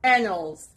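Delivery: "annals" with AN stressed, falling away; teacher's model "annals", weight on AN; stress on first syllable. Shown as a demonstration. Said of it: "The stress is on the first part".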